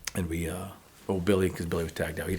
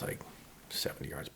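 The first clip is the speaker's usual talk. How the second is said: ordinary delivery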